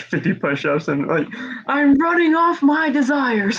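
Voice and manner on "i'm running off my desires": high, slow, hammed-up declaration; mock exertion cry. Imagining a friend doing push-ups on live, mock exertion cry.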